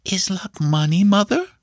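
put-on high, tentative child's question; timid boy. A narrator's reading. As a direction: ask mother cautiously in a small high voice. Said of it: "rather timidly".

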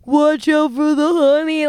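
mock slow-motion warning. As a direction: draw out the warning like a slowed-down replay. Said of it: "it is slow motion".

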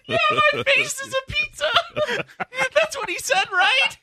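high squealing laughter; laughing. Real, not acted. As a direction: talk through helpless laughs. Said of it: "I just turned on my radio".

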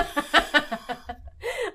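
high, eerie laugh thrown back; head-back laugh. Acted. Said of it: "she throws her head back like that and laughs".

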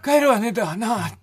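high, strained mock of a blown-out voice; imitating lost voice. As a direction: mimic your partner with no voice left. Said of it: "この声で、この声で、そう言ってたんです、あなた". (声が潰れた相方の真似)

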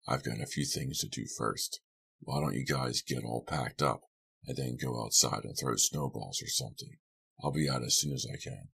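low, soft man's voice; subdued, unhurried character voice; stalling Lou. A narrator's reading. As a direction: put them off quietly, in a low voice.

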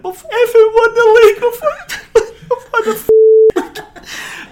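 very high, loud mock sobbing wail; hammed-up crying. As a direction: wail it out, imitating an inconsolable emotional wreck. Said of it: "I broke down crying".

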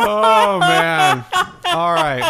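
words half swallowed by laughter; laughing. Real, not acted. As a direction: still laughing, breathless. After a long laugh.

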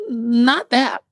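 high dismissive no. Retold or imitated. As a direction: wave the idea away. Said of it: "I was like".

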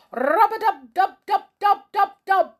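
high, loud drumming sounds; imitated drum roll. Band playing soldiers in, drum roll. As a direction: rattle it like a drum. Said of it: "went the drums".

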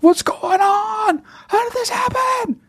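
high-pitched hammed-up wailing; mock crying. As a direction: cry out in slow, exaggerated despair. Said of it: "one of them is crying".

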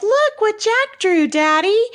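high, singsong mock little girl; put-on high-pitched kid voice. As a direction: chirp it up high like a child showing off to Daddy. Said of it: "she pops right up and she's like".

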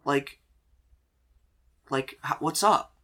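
lightly voiced awkward guy; Dean. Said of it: "He goes".

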